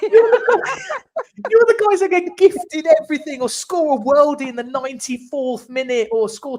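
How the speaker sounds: high, loud mock rant with laughter; hammed-up rant at rival fans, pitched high; retold rant. Ranting at Man United fans, loud, high and laughing. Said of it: "I was like".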